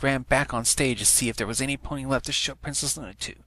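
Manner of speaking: usual speaking voice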